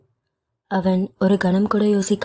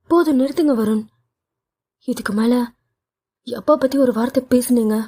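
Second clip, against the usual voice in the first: angry cut-off; 4 semitones higher, faster than usual. Acted. Wife cutting husband off angrily.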